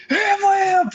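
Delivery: mock narrator yelling ham lamp; real loud outburst. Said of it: "Like, real loud".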